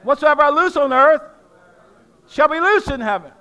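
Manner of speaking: loud, high preaching declaration; proclaiming loudly. Shown as a demonstration. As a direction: call it out like a proclamation to the church. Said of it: "Somebody say this".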